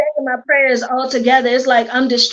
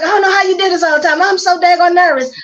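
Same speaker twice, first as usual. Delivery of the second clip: nervous rush; 6 semitones higher, 3 dB louder, faster than usual. Retold or imitated. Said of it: "And I was like".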